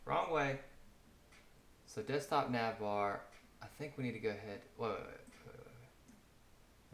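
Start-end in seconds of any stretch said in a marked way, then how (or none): 0.1-0.6 sudden exclamation. Real, not acted. Catching his own mistake. 4.8-6.9 breathless rapid whisper, dying away; whispered wait. Real, not acted. Coder halting himself mid-edit, whispered.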